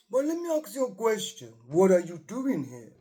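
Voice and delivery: lightly voiced grand old actor; a hint of a deep theatrical voice; Christopher Lee. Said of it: "he's got a really deep voice".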